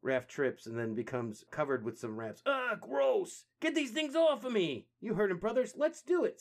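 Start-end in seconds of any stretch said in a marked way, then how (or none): 2.4-4.9 high, loud turtle yell; disgusted yell. Acted. Raph covered in rats. 5.0-6.4 high, loud heroic call; rally your brothers to attack. Acted. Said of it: "says Leo".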